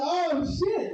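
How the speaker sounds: high, shocked exclamation; mock shock; stunned. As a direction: blurt it in disbelief. His stunned reaction.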